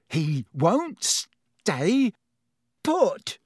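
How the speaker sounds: slow, then a high cry; straining to hold on; strained. Acted. Ron straining with wriggling rat.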